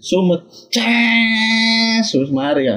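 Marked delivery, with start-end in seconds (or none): 0.7-2.1 long drawn-out high "cesss"; stretched fizzing noise; imitated fuse hiss. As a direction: draw out the sizzle of a lit firecracker. Imitating the firework fuse sound.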